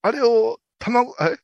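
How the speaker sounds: high, slow indignant rant; mock angry rant. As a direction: rail at it in disbelief. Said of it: "すごい剣幕で怒るんですよ".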